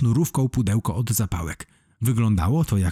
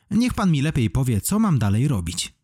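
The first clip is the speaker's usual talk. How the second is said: plain reading voice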